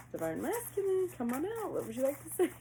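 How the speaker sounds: high-pitched sing-song calling; playful coaxing. Pretend. Reader calls the masculine out in hide-and-seek, playfully coaxing. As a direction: coax someone out of hiding, high and teasing.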